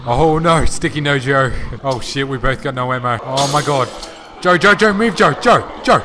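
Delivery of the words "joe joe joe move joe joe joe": higher and louder, yelling Joe to get moving; urgent calling. Real, not acted. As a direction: call him out of the way fast. Calling teammate Joe to move.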